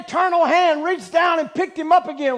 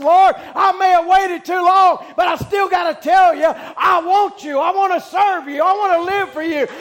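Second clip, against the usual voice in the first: crying out; 4 semitones higher, 5 dB louder than usual. Retold or imitated. Young man pleads with the Lord, loud crying out. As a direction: beg God with full voice. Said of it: "And he cried out".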